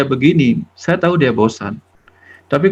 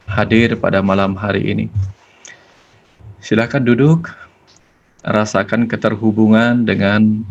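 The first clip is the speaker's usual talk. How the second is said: guided prayer; 2 semitones lower than usual. Acted. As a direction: lead the listeners gently into silent prayer. Leads a closing prayer. (doa yang tenang dan pelan)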